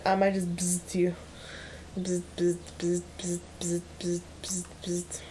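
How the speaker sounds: low buzzing zap noises fading to a whisper; bug zapper zap. Pretend. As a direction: buzz like an electric zapper, dying away. Zapping the enemy Steven.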